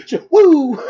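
squeaky high woo sound; a quick high woo like someone flying off a bike; high mock woo. Imitating bike crash noise.

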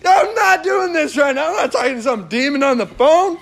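high-pitched, exasperated mock outburst; comic indignation. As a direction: protest in hammed-up alarm, pitch shooting up. Acts his panicked reaction on phone.

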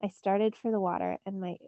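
plain reading voice

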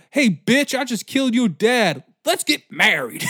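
loud, high mock-brash yell; hammed-up loud conqueror; mock shout. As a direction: bellow it like a swaggering killer proposing.

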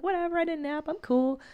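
put-on breezy toddler who doesn't care; easygoing little boy. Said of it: "he's so easygoing".

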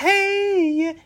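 very high, drawn-out cartoon call; He-Man. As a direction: imitate a cartoon hero's high-pitched holler. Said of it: "in He-Man where he goes".

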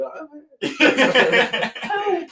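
high, drawn-out giggling voice; laughing. Pretend. Pretending to steer the boat, laughing. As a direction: giggle the words out high and slow. Acting herself steering toward iceberg.

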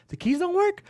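very high questioning lady voice; high mock clerk asking; imitating desk lady. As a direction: squeak it up like the clerk.

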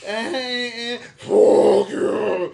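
revving up into a loud, drawn-out demonic roar; possessed demon. Retold or imitated. Imitating the woman cursing the manager, demon-possessed voice. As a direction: build from grunts to a possessed howl. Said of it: "she was possessed by a demon".

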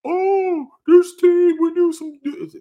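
high, slow, smug fan voice; imitating a cocky Dodgers fan bragging about stars; mocking Dodgers fan. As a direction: brag in a high, drawn-out mocking voice. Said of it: "they're gonna be like".

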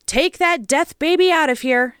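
put-on high, wild cry from an old woman. A narrator's reading. Old woman driving the child from her house, crying out. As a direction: screech it in alarm. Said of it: "she cried in English".

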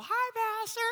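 very high, sing-out mock friendly hello; friendly greeting. Shown as a demonstration. Preacher showing the nice greeting it was not, mock friendly. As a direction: chirp it as a harmless greeting. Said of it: "No, it's, "Pastor," you know".

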